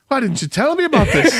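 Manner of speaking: mock amazed foreigner. Imitating Brit discovering ranch.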